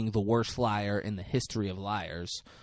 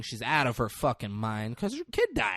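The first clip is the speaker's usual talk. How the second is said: ordinary talk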